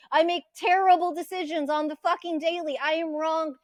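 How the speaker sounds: usual speaking voice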